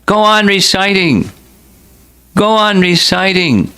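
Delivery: high, ringing repeated command; exclaiming. A narrator's reading. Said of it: "repeatedly exclaimed".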